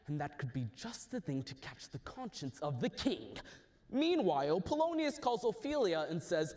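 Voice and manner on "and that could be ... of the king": lower, softer scheming. Acted. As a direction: plot it under your breath. Hamlet plots.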